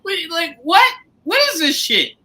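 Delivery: high, loud, outraged soldier ranting; high-pitched indignant yelling at his lord; mad as hell. Acted. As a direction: rant like a betrayed soldier who was forced to fight. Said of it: "gotta be mad as hell".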